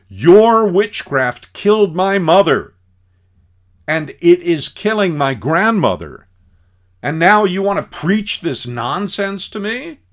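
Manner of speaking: imitating an enraged son, high and loud; furious accusation. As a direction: hurl the blame at him. Son accuses the medicine man.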